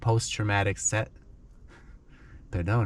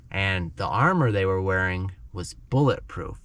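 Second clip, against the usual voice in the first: ordinary delivery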